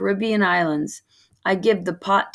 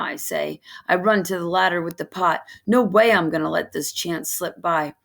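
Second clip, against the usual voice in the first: usual speaking voice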